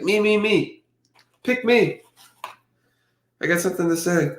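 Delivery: high, pleading voicing of the dog begging to be picked; eager pet voice. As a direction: play the dog raising a paw for attention.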